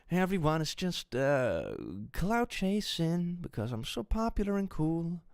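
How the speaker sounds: high, slow smug creator; mock YouTuber excuse. Creator dismisses critics in a high, slow smug voice. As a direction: brush it off as clout chasing. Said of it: "That toxic gossip train".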